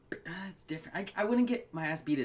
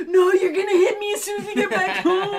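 much higher, louder mock scream, laughing through it; hammed-up little sister screaming in panic; imitating sister's scream; 12 dB louder than usual. Retelling his sister screaming at mom, laughing mock scream. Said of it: "she'd scream".

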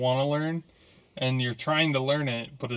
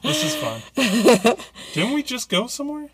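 much higher, squealing; squeaky high voice; 13 semitones higher, 6 dB louder than usual. Pretend.

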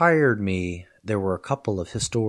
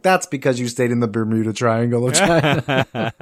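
louder, laughing comeback; laughing retort; 3 semitones higher, 6 dB louder than usual. Retold or imitated. Professor answering jaded students, laughing. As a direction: tease the tourist student. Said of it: "And I'm like".

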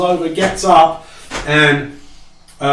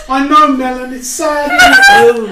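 squeaky sympathetic coo; high consoling voice; 11 semitones higher, 5 dB louder than usual. Acted. The elf consoles her little flying lizard.

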